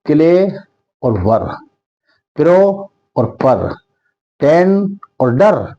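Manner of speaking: "Clay", "वर", "pro", "पर", "टेन", "डर" each set apart; words halved with pauses, "टेन" और "डर"; syllable by syllable. Shown as a demonstration. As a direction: give each half, like "clay" and "वर", its own beat. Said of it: "ये शब्द double syllable वाले हैं".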